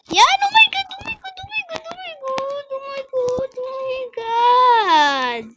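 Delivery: high, loud, terrified shrieks of oh my God; panicked screaming. Real, not acted. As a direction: scream in real panic as you fall. Screaming while falling in game.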